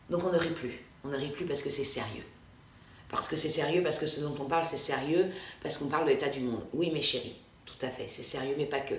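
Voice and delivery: mock-grave, hushed and low; mock serious. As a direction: hush it like a solemn guru. Mocking overly serious people. (gravement moqueur, à voix basse)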